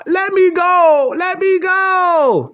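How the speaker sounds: high, slow whiny plea, mocking; mock pleading. Mocking the crying arrestee, high slow begging. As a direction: imitate a scared man begging to be let go. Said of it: "all that crying and you screaming and hollering".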